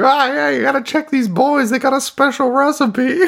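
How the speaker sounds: very high, hammed-up puppet informant; high-pitched puppet voice. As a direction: squeak the tip-off like a silly dummy. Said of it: "He's like".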